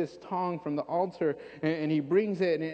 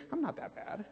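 softer, inner-voice murmur; secret thought; 9 dB quieter than usual. Retold or imitated. A sinner's private thought, said much softer. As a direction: say it under your breath. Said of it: "you're really thinking".

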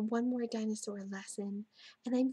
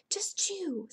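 insistent; 8 semitones higher than usual. A narrator's reading. Jack insists Annie obey.

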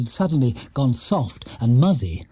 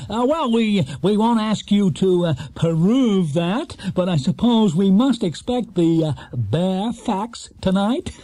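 fumbling, drawn-out TV host; hesitant host; 5 semitones higher, slower than usual. Acted. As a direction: stall with ums like a nervous host. Ronnie Playfair voiced in story.

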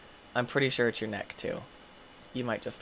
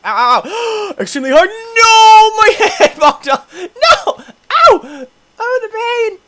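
high, loud mock yelps of pain; cartoonish ouch shrieks, half laughing; hammed-up pain cries; 13 dB louder, slower than usual. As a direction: overplay the agony for laughs. Hammed-up pain as ragdoll hits.

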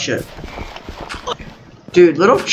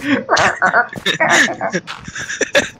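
higher, drawn-out mock choking laugh; imitated choking laugh; 6 semitones higher, 3 dB quieter than usual. Showing the friend how he sounded, choking laugh. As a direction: mimic a laugh breaking into choking. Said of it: "That's what it sounded like".

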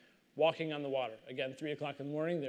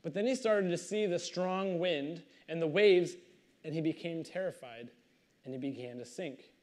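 usual speaking voice

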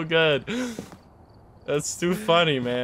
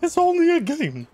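whiny meme voice; 11 semitones higher than usual. Retold or imitated. Repeating the meme line.

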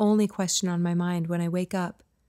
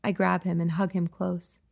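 plain reading voice

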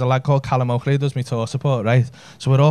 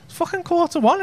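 shocked. Retold or imitated. Shocked at last orders.

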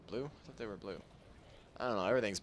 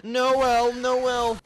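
loud high call across; 13 semitones higher, 12 dB louder than usual. Real, not acted. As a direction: shout the name out. Calling out a name loudly.

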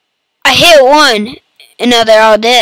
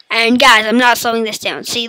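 ordinary delivery

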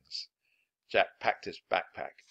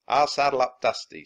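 lightly voiced cowboy, a bit louder; cowboy Slim; 4 semitones higher, 9 dB louder than usual.

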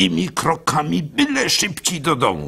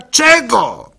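pressing question; 11 semitones higher, 7 dB louder than usual. Acted.

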